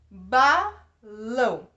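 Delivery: ba-lão said slowly, syllable by syllable; split into syllables. Shown as a demonstration. Teacher splitting the word for students, slow syllables. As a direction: spell out balão in slow separate beats. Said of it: "dissílaba".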